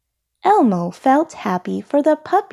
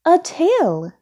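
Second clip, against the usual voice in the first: high, interrupting shopkeeper; pitched-up salesperson reaction; 9 semitones higher than usual. A narrator's reading. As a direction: cut in with a raised voice. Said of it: "said the salesperson".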